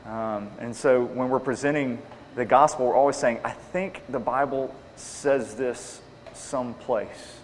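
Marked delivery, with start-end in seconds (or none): 3.4-7.4 slow, halting mock recollection; imitating someone unsure of their source; imitated vague uncertainty. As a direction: grope vaguely for a half-remembered verse. Mimicking believers vaguely citing the Bible.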